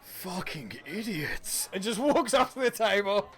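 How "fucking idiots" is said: low, soft, slow mutter; contemptuous mutter. Acted. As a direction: drag it out under your breath.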